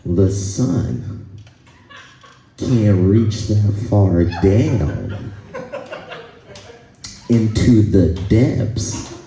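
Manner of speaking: very slow, pause-laden pseudo-scientific explanation; earnest mock lecturer. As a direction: spell it out gravely, phrase by phrase. Acts man giving earnest scientific reason.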